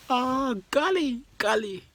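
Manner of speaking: high, loud delighted exclamation; real high-pitched amazement; amazed outburst. Real, not acted. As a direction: burst out, thrilled and amused. Cracking up at friend's impression.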